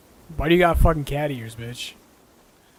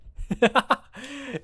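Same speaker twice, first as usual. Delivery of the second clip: high laugh; 9 semitones higher than usual. Real, not acted. Laughing at the dull game.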